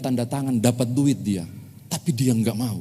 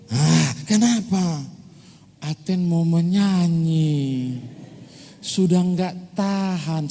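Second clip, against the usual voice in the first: put-on higher, slow whiny little boy; small child voice; 5 semitones higher, slower than usual. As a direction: plead like a five-year-old who can't hold it. Little grandson waking grandma.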